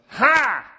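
sharp high burst of laughter; loud scoffing laugh. Retold or imitated. Sarah laughing out loud in disbelief, loud scoff. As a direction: blurt out a mocking laugh. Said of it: "It isn't like she goes".

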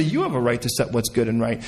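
deference. Acted. Believer focused on the Lord hands over the right, deferential. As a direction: drop the pitch and yield on "You". God-focus set against self-focus.

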